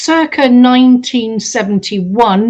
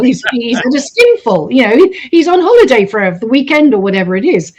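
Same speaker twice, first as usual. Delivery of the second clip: ordinary talk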